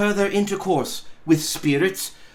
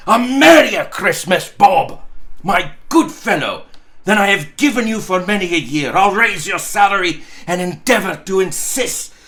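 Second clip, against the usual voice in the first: louder, jolly and booming; hearty cheer; 4 semitones higher, 6 dB louder than usual. Acted. Scrooge clapping Bob on the back, loud and jolly. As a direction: shout good cheer to an old friend. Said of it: "with an earnestness that could not be mistaken".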